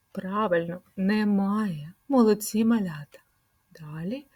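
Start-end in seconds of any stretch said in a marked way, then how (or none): none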